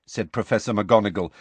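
plain reading voice

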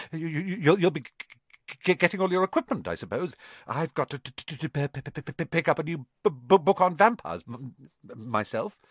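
high, halting stutter with rushed bursts; nervous stammer. A narrator's reading. Quirrell talking about vampire books, stammering nervously. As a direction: stumble over g, p and b.

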